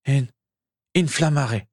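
stammered spell. A narrator's reading. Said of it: "bredouilla-t-il".

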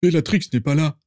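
a quick protest, voiced up. A narrator's reading. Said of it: "protesta aussitôt Rodolphus".

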